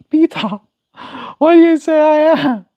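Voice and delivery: high mock Lois impression; Lois accent. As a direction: squeak it out like Lois from Family Guy. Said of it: "try a Lois accent".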